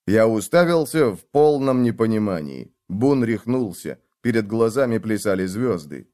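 ordinary delivery, nothing marked

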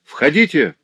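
high, loud summons; tense shout. A narrator's reading. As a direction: call them in with urgent tension. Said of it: "закричал доктор с напряжённым волнением".

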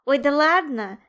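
loud, mocking disbelief; playful scoffing; giggly teasing. A narrator's reading. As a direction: poke fun at a friend's tall tale. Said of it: "хихикнул Лунчик".

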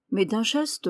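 ordinary delivery

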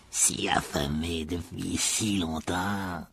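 very slow, whispery hungering menace; cold deadly voice. A narrator's reading. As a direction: draw it out in a chilling breath. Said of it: "voix froide et mortelle". (murmure froid, lent et mortel)

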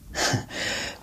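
quiet breathy little laugh under the breath; soft unvoiced laugh; soft chuckle. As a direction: chuckle fondly at the memory.

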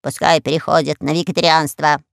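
low croaky parrot. Acted. Parrot to the queen, setting terms in a low voice. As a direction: state the terms like a pirate parrot.

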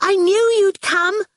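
put-on high-pitched little-girl gasp. Awful greets her rescuer, gasping. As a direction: gasp it out in relief. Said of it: "Awful gasped".

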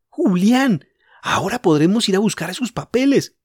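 high, eager call; rally the others; girl keen for action. Acted. Jorge eager.

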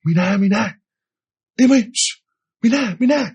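imitated hushing of a barking dog; high-pitched hush to a dog; calling the dog. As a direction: call the dog's name, then shush it. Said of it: "anh mới đứng anh kêu".

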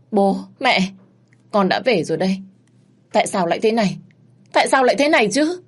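high, loud grief building; grieving son crying out to parents; anguished cry. Acted. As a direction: wail at the coffins. Son arrives at coffins.

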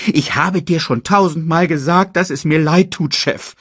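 high, loud apologetic henchman; Banzai. A narrator's reading. Hyena apologising to the annoyed boss, high and loud. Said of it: "sagte Banzai zu dem verärgerten Bruder".

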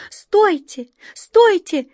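high, loud desperate shouts; urgent high-pitched yell; desperate cry. A narrator's reading. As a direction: call out in despair to stop them. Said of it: "отчаянно закричала белка".

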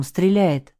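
ordinary delivery, nothing marked

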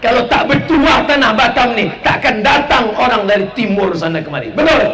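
high, loud rising proclamation; full-force preaching. Acted. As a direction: call it out to the whole crowd.

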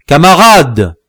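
loud, high hail to followers; repeated thundering call. A narrator's reading. Chief hailing his men from the crevice, thundering call. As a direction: call out with authority. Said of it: "répéta-t-il".

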